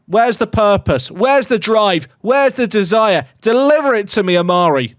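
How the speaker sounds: rousing, repeated punchy questions; exasperated demands. Real, not acted. Berating team for boring play.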